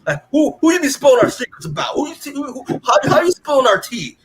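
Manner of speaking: high, sassy teasing voice; mocking jerk voice. Retelling Rex mocking Eve, high sassy jerk voice. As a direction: squeak it out like a smug jerk. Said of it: "Rex being a jerk".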